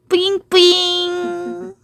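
put-on high, slow cutesy baby talk; uwu speak. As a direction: coo it like a cute aegyo sound. Cute baby talk to newborn. (애교 섞인 귀여운 말투)

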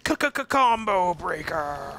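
drawn-out, stuttered game-announcer shout; combo breaker announcer. Acted. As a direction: call it out slowly like an arcade announcer. Mimics fighting-game announcer call.

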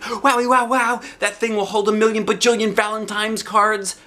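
high, loud, amazed wow; thrilled. A narrator's reading. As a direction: gush over the giant box. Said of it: "I said, real thrilled".

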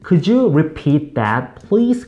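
very slow, rising please; slow polite request. Shown as a demonstration. Teacher models asking someone to repeat, slowly. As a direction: lay out each word, polite ask. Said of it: "어떻게?".

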